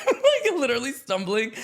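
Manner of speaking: high, loud laughing words. Real, not acted. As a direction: crack up while telling it. Laughing at own drunken stumbling.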